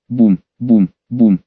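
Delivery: low, heavy booms like footsteps; booming onomatopoeia. A narrator's reading. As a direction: boom it out like a giant stomping. Narrator voices giant footsteps. (низкое гулкое «бум»)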